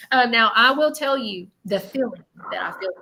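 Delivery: normal talking voice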